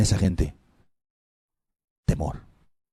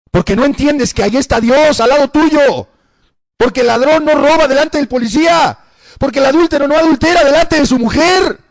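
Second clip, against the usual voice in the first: high, loud preaching shout; 9 dB louder than usual. Real, not acted. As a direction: thunder it at the congregation. (predicación a gritos, voz alta y fuerte)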